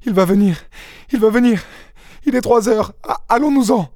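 very high, urgent panic; panicked cry. A narrator's reading. Young wife begging to flee before he comes, panicked. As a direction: plead in a high, frightened voice. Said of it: "cria-t-elle".

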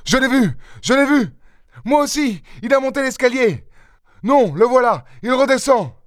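very high, urgent short shouts; excited cries. A narrator's reading. Sons spotting the thief on the stairs, excited cries. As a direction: call out sightings, pitch leaping on each burst.

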